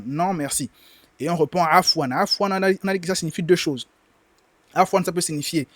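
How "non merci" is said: ordinary talk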